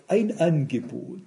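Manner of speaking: quiet, slow, unruffled answer; low and slow, deadpan calm; calm reply. Retold or imitated. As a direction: say it calmly and softly. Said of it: "ich hab dann ganz ruhig nur gesagt".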